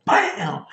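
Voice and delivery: high, drawn-out "bam"; slow-motion sound effect of a big shove; imitated impact. Friend retells his hardest push ever, drawn-out impact noise.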